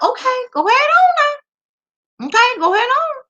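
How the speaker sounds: sarcastic dare. Real, not acted. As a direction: tease them to try it.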